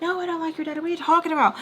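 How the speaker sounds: high-pitched mock affront; retold indignant denial. Teller denying baby's accusation, high-pitched mock indignation. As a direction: push the pitch way up, offended. Teller snapping at a child's question.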